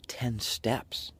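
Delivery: breathy whisper of ten steps. Acted. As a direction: whisper it like a secret. Whispered focus.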